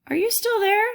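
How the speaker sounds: high, loud, plaintive call. A narrator's reading. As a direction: ask it pitifully and high. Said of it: "the voice asked plaintively".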